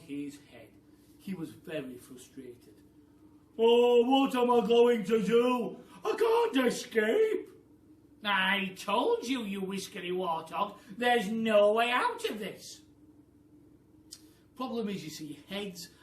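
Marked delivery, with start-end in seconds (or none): 3.6-7.5 high, loud, slow wail of despair; panicky Mr Twit. Acted. Upside-down Mister Twit wailing he can't escape. 8.2-12.8 loud, scornful nag; scolding Mrs Twit. Acted. Missus Twit scolding her trapped husband, loud and scornful.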